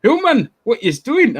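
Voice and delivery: high, drawn-out mock Geordie; Geordie accent. Geordie local challenges the London interpreter, puzzled Geordie accent. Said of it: "the Geordies were like".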